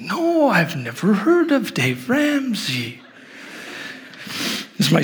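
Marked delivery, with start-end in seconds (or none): 0.0-3.0 put-on high, slow, drawn-out little-girl voice; singsong imitation of a guileless child; innocent little girl. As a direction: mimic a wide-eyed six-year-old, stretching the words. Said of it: "she's so innocent".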